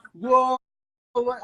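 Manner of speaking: high, loud shocked exclamation; imitating Chief's stunned reaction; startled whoa. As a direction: blurt it like you're stunned. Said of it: "freaked out".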